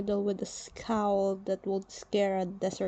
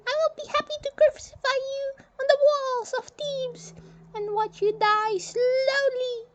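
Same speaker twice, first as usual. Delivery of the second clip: a much higher, firm general announcing the burial; stern general voice; 3 dB louder than usual. Acted. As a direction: speak as a proud Egyptian general, high and set. General threatens.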